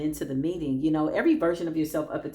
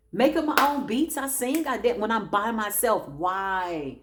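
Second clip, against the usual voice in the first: ordinary delivery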